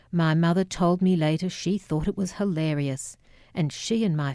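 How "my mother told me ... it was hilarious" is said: usual speaking voice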